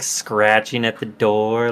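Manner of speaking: normal talking voice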